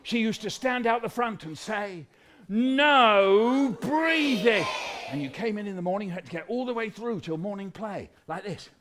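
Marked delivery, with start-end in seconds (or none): 2.5-4.6 very slow, loud drawn-out command; booming schoolteacher ban; strict teacher. Acted. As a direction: stretch each word into a stern order. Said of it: "a teacher who was so strict".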